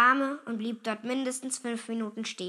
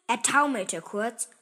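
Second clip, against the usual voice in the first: plain reading voice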